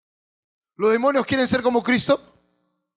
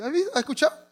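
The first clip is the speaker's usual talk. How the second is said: normal talking voice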